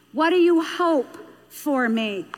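"What do you hope for me?" drawn out deliberately; slow, weighty repeat. Shown as a demonstration. Speaker repeating the child's question to parents, slow and emphatic. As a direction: stretch HOPE and let "for me" land slowly.

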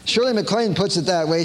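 ordinary talk

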